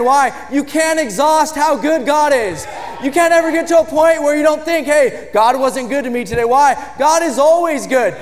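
God is good stretched out and shouted; full-force preaching. Real, not acted. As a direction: drive it home to the congregation.

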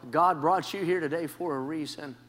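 normal talking voice

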